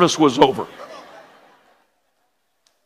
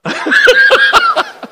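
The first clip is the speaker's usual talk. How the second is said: high, breathy burst of laughter; big high laugh. Real, not acted. Pastor laughing at the donor's reversal, high laugh. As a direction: let the laugh burst out at your own story. Pledge taken back, then laughing.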